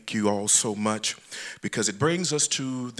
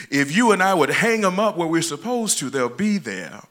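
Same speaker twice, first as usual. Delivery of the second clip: higher and louder, driving the point home; louder emphatic repeat; 4 semitones higher, 6 dB louder than usual. Real, not acted. Preacher repeating to the congregation, louder and higher.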